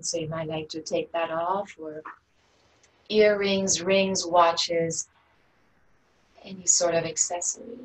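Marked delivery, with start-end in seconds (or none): none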